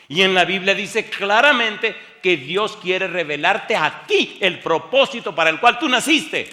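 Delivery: loud, high, slow preaching that climbs to a peak; preaching at full force. Acted. Pastor proclaiming God's purpose to the church, loud high preaching. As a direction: build it up and proclaim every phrase.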